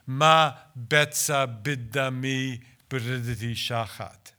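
"Ma betza bidami b'riditi shachat" chanted in Hebrew; Hebrew recitation. Shown as a demonstration. Translator reciting the whole Hebrew line for the audience. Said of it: "I'll recite the whole Hebrew line".

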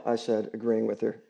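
plain reading voice

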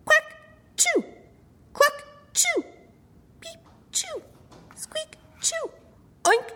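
high, quick animal sneezes in turn; chorus of sneezing animals. A narrator's reading. Narrator voicing the whole barn sneezing. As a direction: run through each animal's sneeze.

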